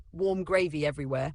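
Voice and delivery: ordinary delivery, nothing marked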